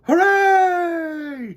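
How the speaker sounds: high, loud joyful shout; cheering bees. Acted. Fellow bees cheering Mister Bumble's golden gift. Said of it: "cheered his fellow bees".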